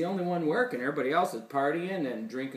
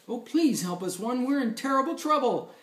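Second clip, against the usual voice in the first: higher, hurried plea for rescue; storybook characters in trouble; pleading trains; 10 semitones higher, 4 dB louder than usual. A narrator's reading. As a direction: beg the hero, pitch climbing.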